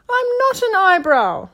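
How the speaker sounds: very high, loud little creature voice; indignant tiny caterpillar squeak. A narrator's reading. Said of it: "squeaked the eyebrow".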